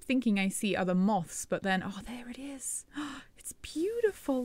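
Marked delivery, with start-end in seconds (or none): 1.8-2.8 breathless whisper at the moth; hushed whisper. Real, not acted. Whispering to a moth she just spotted. 3.4-4.4 high, breathy whisper of wonder; awed whisper. Real, not acted. Whispering in awe at the glowing moth.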